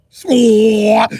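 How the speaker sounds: sharp, loud impact noise; loud swat sound; loud smack effect. Acted. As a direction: slam it out. Acting out ramming boats.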